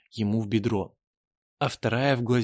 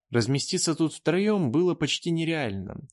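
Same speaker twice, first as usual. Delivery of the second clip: ordinary delivery, nothing marked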